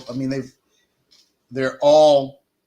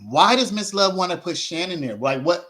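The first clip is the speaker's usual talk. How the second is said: ordinary talk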